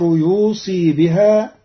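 يوصي بها lifted with the saad in kasra. Shown as a demonstration. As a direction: read يوصي as yusi, clearly. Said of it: "بكسر الصاد".

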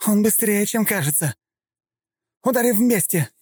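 high, sharp female cry; urgent shout. A narrator's reading. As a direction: call out to your partner. Assassin calls to partner mid-fight.

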